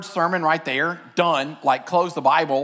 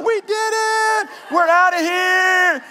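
high, slow, loud victory cry; exultant shout; 12 semitones higher, 6 dB louder, slower than usual. Pretend. Believers high-fiving in heaven, a high triumphant shout. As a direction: celebrate like you just won it all.

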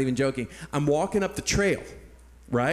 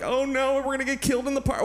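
imitating a panicked mother, pitched high and fast; high, hurried mock alarm; 9 semitones higher than usual. Mother fearing the stranger in the park, mock panicked.

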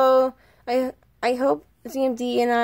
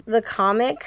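ordinary delivery

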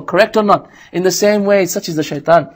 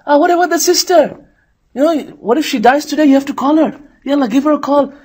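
urging shaitan voice; 8 semitones higher than usual. Retold or imitated.

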